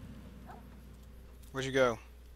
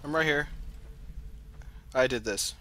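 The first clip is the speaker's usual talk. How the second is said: ordinary delivery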